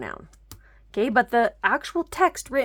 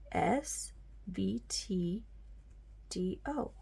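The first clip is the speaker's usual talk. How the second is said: soft, slow whispered letters; hushed slow spelling-out; 10 dB quieter, much slower than usual. Shown as a demonstration. Teacher whispers pattern letters.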